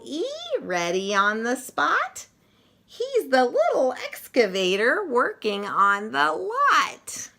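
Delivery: lively picture-book read-aloud with punchy sound words; reading to children. Acted. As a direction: read it like story time for little kids, full of bounce.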